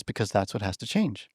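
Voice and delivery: soft, low-key voicing of the dog; dog's voice, hushed. The chihuahua telling the psychic what must change, softly. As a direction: quietly relay what the dog is telling you. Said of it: "And the dog says".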